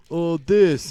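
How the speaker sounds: loud, high dopey blurt; dumb student voice. Retold or imitated. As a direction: blurt it out like a clueless kid. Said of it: "said something stupid like".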